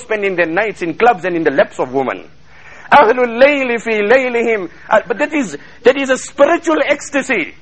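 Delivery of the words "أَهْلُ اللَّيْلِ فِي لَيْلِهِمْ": rapid chanted Arabic quotation; Arabic recitation. Acted. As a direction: rattle off the Arabic saying in one breath.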